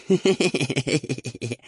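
high wheezy devil snicker; rapid hissing giggle; hissing laughing fit. A narrator's reading. Devil laughs at shell in ghoul's eye, hissing giggle. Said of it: "зашипел в припадке смеха чёрт".

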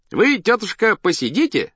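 high, bright-pitched circus patter; showman addressing his act. A narrator's reading. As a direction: play the host talking up to the crowd. Showman addresses dog before audience. (голос циркового артиста на публику)

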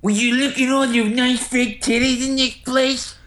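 very high, squeaky put-on voice; Turtle. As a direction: hold one high pitch all through, hammed-up. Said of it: "You do the best Turtle voice".

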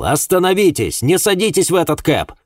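high, urgent cry to stop them; urgent shout. A narrator's reading. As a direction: shout a frantic warning across the street. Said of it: "выскочил на улицу и закричал".

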